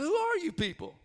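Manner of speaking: delighted disbelief at the visitors; amazed. Retold or imitated. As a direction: pastor marvels at the fasting strangers. Amazed at the strangers.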